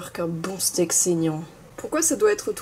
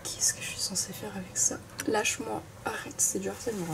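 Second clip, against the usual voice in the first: whispering; 10 dB quieter than usual. Real, not acted. Slime poured on her.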